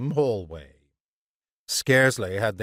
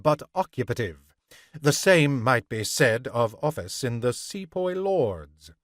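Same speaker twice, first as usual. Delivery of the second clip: ordinary delivery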